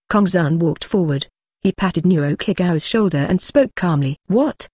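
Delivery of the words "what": sharp high rising gasp of a question; startled what, pitched up; shocked what. A narrator's reading. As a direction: jolt up in alarm on the word. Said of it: "Nokagao looked horrified".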